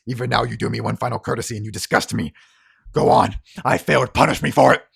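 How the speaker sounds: loud, bitter taunting; angry goading. Acted. Harlan dares Othello to shoot him, angry. Wounded man goading his killer.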